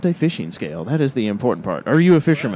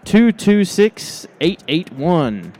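slow drawn-out number call; auctioneer; 5 semitones higher, 4 dB louder, much slower than usual. Acted. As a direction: stretch each number like a game-show host. Reading out a winning ticket.

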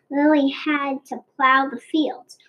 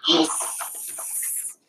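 snake hiss; 2 semitones higher, 6 dB quieter than usual. A narrator's reading. Snake jumps at the dragon.

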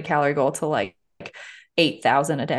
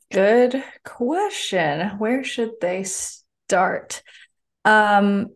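thinking aloud; 3 semitones higher, much slower than usual. Real, not acted. Pondering the host's question.

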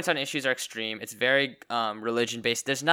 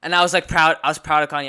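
excited and proud; 4 semitones higher, 9 dB louder, faster than usual. Real, not acted. Emphatic pride.

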